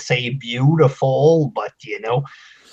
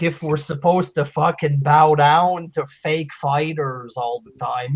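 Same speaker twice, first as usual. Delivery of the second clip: ordinary talk, nothing marked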